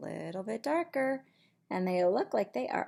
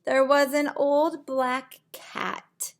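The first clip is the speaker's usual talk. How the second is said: lively storybook voice for little ones; reading to children; 6 dB louder than usual. Acted. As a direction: read it like bedtime story time. Reads picture book aloud.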